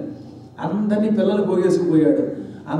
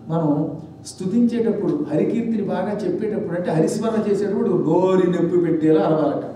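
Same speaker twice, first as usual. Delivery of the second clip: ordinary delivery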